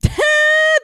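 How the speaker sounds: loud, very high burst of laughter; big high laugh. Real, not acted. Host after a listener's pun, loud high laugh.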